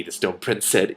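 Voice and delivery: ordinary delivery, nothing marked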